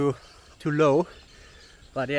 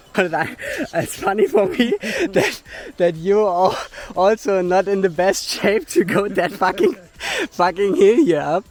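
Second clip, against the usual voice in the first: helpless laughs breaking up the words; real laughing while speaking; 4 semitones higher than usual. Real, not acted. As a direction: talk through real laughter, pitch jumping up. Laughing at own exhaustion on a climb.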